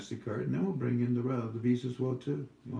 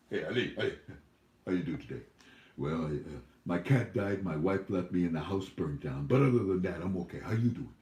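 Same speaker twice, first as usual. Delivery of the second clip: lightly voiced Iraqi chat, lower; 6 semitones lower, 3 dB quieter than usual. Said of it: "Over there".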